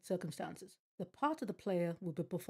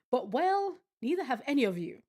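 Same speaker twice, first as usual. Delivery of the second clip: emcee voice; 6 semitones higher, 7 dB louder than usual. Acted. Emcee addresses the audience.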